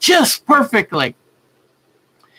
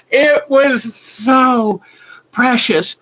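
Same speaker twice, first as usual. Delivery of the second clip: real tears catching the voice; fighting back happy tears; slow, teary and moved; choked up; 5 semitones higher, much slower than usual. Real, not acted. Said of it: "I got all choked up".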